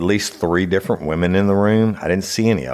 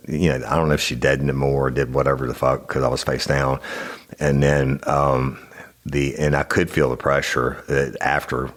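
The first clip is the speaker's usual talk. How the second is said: ordinary talk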